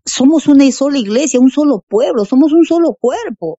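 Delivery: más agudo y fuerte, proclamando con fervor. Real, not acted. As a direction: celebra la unidad de la iglesia. (proclamación fervorosa de unidad)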